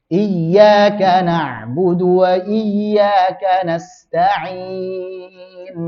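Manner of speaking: slow chanted tajwid recitation; teacher models Quranic chant. Shown as a demonstration. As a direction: recite it slowly, melodic and drawn out.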